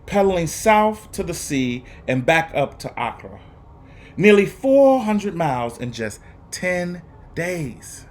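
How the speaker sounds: put-on expressive read-aloud for young students; lively, paced story reading with dramatic pauses; storybook narration. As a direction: read it like a teacher sharing a picture book with the class. Teacher reads picture book aloud.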